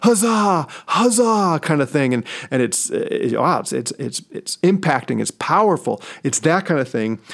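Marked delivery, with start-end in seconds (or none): 0.0-1.6 high cheering soldiers' cry; rally like troops cheering a general; triumphant huzzah of an army; army battle cry. Retold or imitated. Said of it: "the army cries out".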